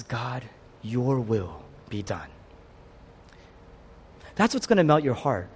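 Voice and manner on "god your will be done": low, soft, slow prayer; quiet submission. Acted. Jesus obeying God before the cross, quietly submissive. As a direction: surrender slowly in a lowered, hushed voice.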